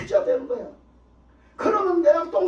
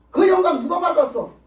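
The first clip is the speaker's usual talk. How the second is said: ordinary talk, nothing marked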